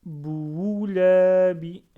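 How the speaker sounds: Bououlabi read slowly, the ou sound drawn out and loud; prolonged waw. Shown as a demonstration. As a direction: stretch the ou in Bououlabi. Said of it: "Là, le waouh, il faut le prononcer".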